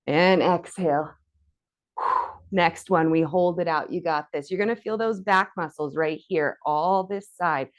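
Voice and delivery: usual speaking voice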